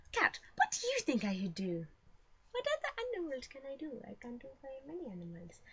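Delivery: high, soft pet-talk voice. Pretend. Vlogger asking the cat for ideas, high and soft. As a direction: coo a question to an animal in a small high voice.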